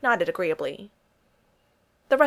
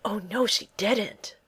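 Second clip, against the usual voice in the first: slow, drawn-out sassy exclamation; sassy oh no she didn't; 3 semitones higher, much slower than usual. Acted. Tony mocking Sam's waitress moment, slow and sassy. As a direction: stretch it out with mock sass. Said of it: "Tony made an ... face".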